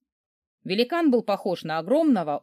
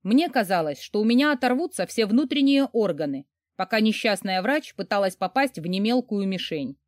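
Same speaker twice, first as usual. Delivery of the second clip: plain reading voice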